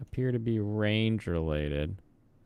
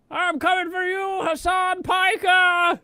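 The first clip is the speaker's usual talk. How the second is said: much higher, loud, slow mock battle cry; villain shout; 7 dB louder than usual. Streamer voicing a game unit threatening Hassan Piker, hammed-up shout. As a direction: bellow a hammed-up challenge across the map.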